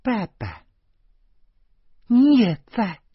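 put-on high, loud surprised child's cry; little girl shouting to her father; girl calling out. A narrator's reading. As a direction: call out to dad in surprise. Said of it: "就听见小魔女喊".

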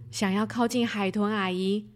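ordinary delivery, nothing marked

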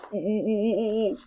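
soft sad cat moan; sighing groan. A narrator's reading. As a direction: let out a defeated sigh. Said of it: "布鲁斯叹了一口气". (布鲁斯泄气地叹气)

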